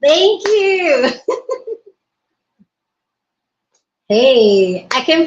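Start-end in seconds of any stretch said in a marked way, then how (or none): 1.2-1.7 high giggle. Real, not acted. Laughs after thanking viewer.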